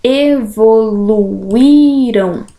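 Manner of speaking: "Evoluíram" very slow, each syllable drawn out. Shown as a demonstration. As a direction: stretch "Evoluíram" to show the stressed í. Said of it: "Qual é a regrinha aqui?".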